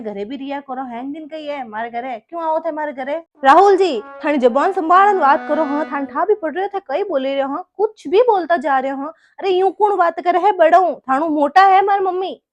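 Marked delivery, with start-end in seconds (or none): 3.4-5.6 loud sharp rebuke; angry warning. Acted. Wife angrily scolds her husband to mind his tongue, loud. 7.8-12.4 higher, louder indignant protest; indignant outburst. Acted. Wife defends her mother against her husband, indignant and loud.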